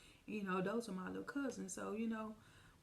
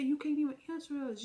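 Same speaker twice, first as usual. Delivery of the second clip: loud, high accusing shout; angry yell; 7 semitones higher, 8 dB louder, faster than usual. Retold or imitated. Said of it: "She said".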